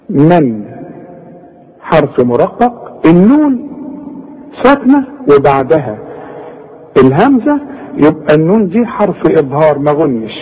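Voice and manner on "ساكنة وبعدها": ordinary delivery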